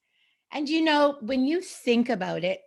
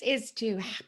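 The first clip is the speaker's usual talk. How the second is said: ordinary talk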